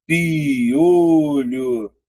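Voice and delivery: "Piolho" split into pi-o-lho, drawn out; syllables separated. Shown as a demonstration. Teacher splitting "Piolho" to show three syllables. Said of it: "eu tenho isso aqui".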